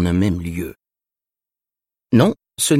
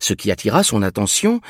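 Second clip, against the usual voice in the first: ordinary delivery, nothing marked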